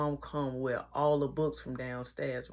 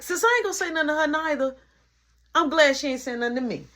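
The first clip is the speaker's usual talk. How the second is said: with an attitude; 9 semitones higher, 5 dB louder, faster than usual. Retold or imitated. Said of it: "I call myself with an attitude".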